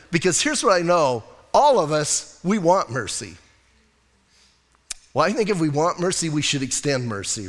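ordinary delivery

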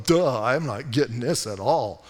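mock-dopey bafflement, pitched low; Daniel. Preacher voices the prophet not getting it, mock dopey. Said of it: "but Daniel's going".

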